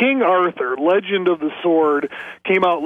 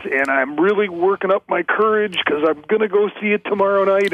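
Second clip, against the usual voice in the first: usual speaking voice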